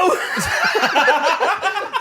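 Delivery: high, loud burst of laughter; real loud laughter at his own story; big laugh. Real, not acted. Laughs after the shout.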